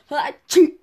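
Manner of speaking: big hammy sneeze; loud fake sneeze.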